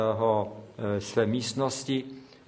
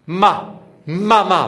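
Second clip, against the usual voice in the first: loud high cry of Ma, mama; cried out; 7 semitones higher, 8 dB louder, slower than usual. Retold or imitated. Revived boy crying out for his mother, loud high cry. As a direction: call out for mother as he wakes. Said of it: "vykřikl".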